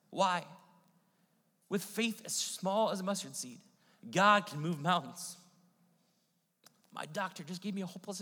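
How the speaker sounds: rally the congregation with full force; fiery preaching. Acted.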